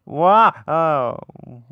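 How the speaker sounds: usual speaking voice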